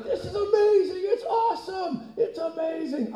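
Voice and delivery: very high, thrilled voice; squealing angel delight. Acted. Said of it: "the angel's like".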